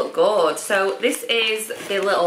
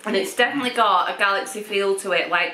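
chewing while talking; mouth full of chocolate. Real, not acted. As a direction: fingers at lips. Seen 2: Woman holds chocolate, fingers at lips, eating while talking.

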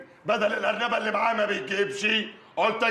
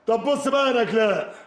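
normal talking voice

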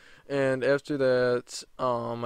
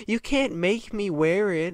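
mocking entitled refusal; bratty Karen; 6 semitones higher than usual. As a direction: sneer like a spoiled child. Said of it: "And the woman was like".

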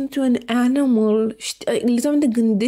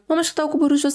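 rushed retake; 3 semitones higher, much faster than usual. Shown as a demonstration.

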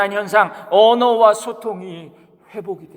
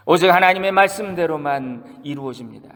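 ordinary talk, nothing marked

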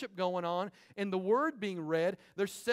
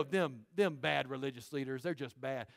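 mock smug churchgoer; 8 semitones lower, 4 dB quieter than usual. Congregation's smug voice mocked.

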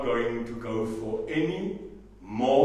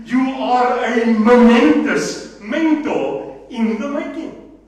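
high, loud, impassioned speech; impassioned orator; fired-up rally; 10 semitones higher, 8 dB louder than usual. Real, not acted. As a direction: preach it to the crowd.

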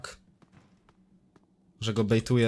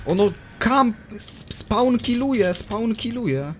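outraged whine; 12 semitones higher, 5 dB louder, slower than usual. Real, not acted. Outraged at monster camping.

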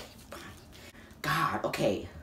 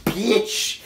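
slow mock taunt; 2 semitones higher, 5 dB louder, much slower than usual. Mock taunt, slowly drawn out.

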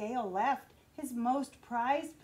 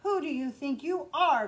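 louder, offended and scornful; proud fish scolding the little fish, louder and indignant; indignant cry; 4 dB louder than usual. Acted. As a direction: snap back, insulted at the request. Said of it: "cried the Rainbow Fish".

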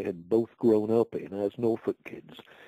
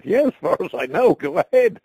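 excited reply; 9 semitones higher, 10 dB louder than usual. Retold or imitated. Retold phone reply, excited.

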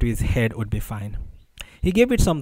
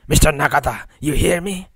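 raised-pitch questioning call; 3 semitones higher than usual. A narrator's reading. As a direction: call out to check someone hears you. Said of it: "he called out more loudly".

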